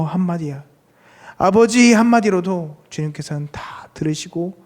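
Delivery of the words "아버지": louder, higher cry of prayer; calling out to Father. Acted. Preacher shows that one word reaches God, calling out higher. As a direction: call on the Father from the heart.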